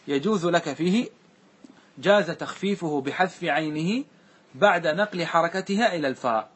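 ordinary talk, nothing marked

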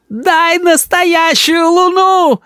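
very high, slow tantrum wail; child's yell. A narrator's reading. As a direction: wail the demand like a spoiled boy. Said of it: "кричал Рис".

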